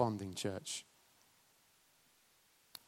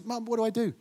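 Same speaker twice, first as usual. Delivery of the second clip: put-on much higher, worried child asking; anxious child voice; 9 semitones higher, faster than usual. Fallen child anxiously asking mum, higher. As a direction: pitch it up like a scared toddler. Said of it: "children who stumble, who fall over and say".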